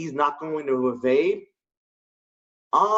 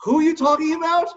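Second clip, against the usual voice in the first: higher, fast, confused "who are you talking about"; baffled question; 12 semitones higher, 3 dB louder, faster than usual. Retold or imitated. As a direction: blurt it out, totally lost. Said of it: "I'm like".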